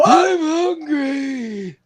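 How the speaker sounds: very high, slow, whiny geezer; old man voice. Pretend. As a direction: wail it like a feeble old man. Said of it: "Give me your best old man voice".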